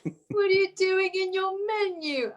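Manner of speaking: high, slow mock British whine; teasing the co-host with her own British accent. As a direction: imitate a Brit nagging from the menu. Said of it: "That's my accent".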